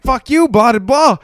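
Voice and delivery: high, loud swearing back; mock angry retort. Berated chef to the host, mock angry shout. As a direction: imitate a chef snapping at the boss.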